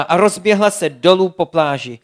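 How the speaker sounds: ordinary delivery, nothing marked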